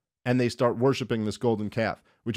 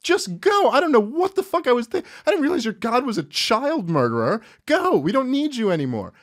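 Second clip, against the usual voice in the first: exasperated giving in; 12 semitones higher, 4 dB louder than usual. Retold or imitated. Pharaoh caving in to Moses, high exasperated yelp. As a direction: throw up your hands and squeal that they can go. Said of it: "the Pharaoh's like".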